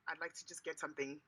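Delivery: ordinary talk, nothing marked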